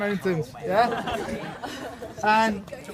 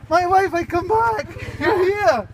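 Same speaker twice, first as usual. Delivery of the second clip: higher and louder happy greeting; joyful return; 9 semitones higher, 4 dB louder, slower than usual. Acted. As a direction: burst in glad to be home. Husband returns home joyfully.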